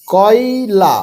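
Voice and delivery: কয়লা stretched syllable by syllable; slow, broken reading. Shown as a demonstration. Teacher showing beginners the first step, read slowly. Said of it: "তাহলে এবার তাড়াতাড়ি পড়ো".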